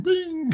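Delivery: high bright "bing" like a phone ding; imitated notification ding. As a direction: sing the notification chime. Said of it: "ding saying".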